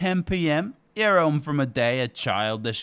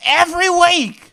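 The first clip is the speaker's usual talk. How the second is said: shout; 12 semitones higher, 13 dB louder than usual. Acted.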